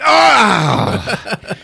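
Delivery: high, loud, drawn-out "ah" of pain; hammed-up monster howl; mock pained cry. Monster hit in the face, loud mock cry. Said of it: "he's like".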